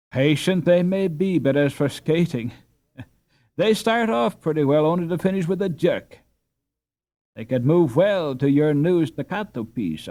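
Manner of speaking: loud, high scornful jeer; mocking laugh. A narrator's reading. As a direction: sneer at the skaters. Karl jeering at the peasants.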